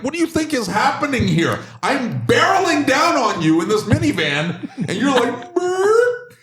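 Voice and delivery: high strained shout at slow drivers; high-pitched frustrated yell. Retold or imitated. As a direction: squeal it out, exasperated.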